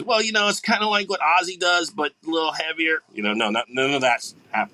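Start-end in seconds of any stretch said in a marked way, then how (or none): 0.0-3.0 higher, hedging mock voice; lightly voiced sheepish excuse-maker. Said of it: "make excuses".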